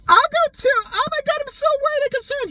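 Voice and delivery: very high-pitched, hammed-up worry; dramatic friend. Friend who loves drama insists on coming, melodramatic. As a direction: gush mock concern in a squeal. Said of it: "He loves drama".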